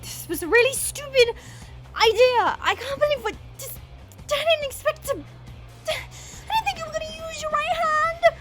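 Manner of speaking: rising, upset and flustered; high, broken-off panicky excuses; distressed apology. Acted. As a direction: stumble through a regret, near tears at the end. Flustered apology after injuring him.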